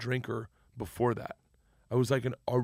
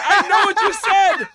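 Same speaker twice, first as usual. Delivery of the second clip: high, loud, slow and laughing with glee; giddy excitement; 13 dB louder, slower than usual. Retold or imitated. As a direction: laugh it out, thrilled to be learning. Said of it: "I got so excited".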